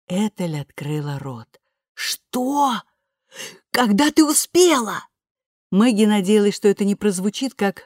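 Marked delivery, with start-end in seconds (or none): 1.9-5.1 high, gasping disbelief; stunned disbelief. A narrator's reading. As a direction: voice shoots up in shock. Ethel stunned by news.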